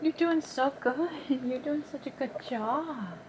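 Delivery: gentle, quiet praise to an animal; soft encouragement. Real, not acted. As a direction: coax her along tenderly.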